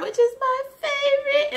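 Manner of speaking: loud, very high burst of delight; excited gushing. Real, not acted. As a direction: squeal it like a devoted fan. Raving about favorite movie.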